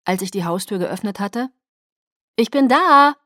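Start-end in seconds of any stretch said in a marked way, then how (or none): 2.3-3.1 high, loud call home; raised, carrying voice. Acted. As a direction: announce yourself to the whole house. Calling out on coming home.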